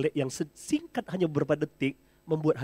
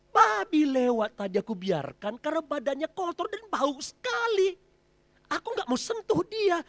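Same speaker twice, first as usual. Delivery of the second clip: higher voiced elephant, a touch indignant; elephant explaining; 12 semitones higher, 5 dB louder than usual. Elephant explains to the herd why it spared the pig, lightly voiced. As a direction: lightly play the big elephant.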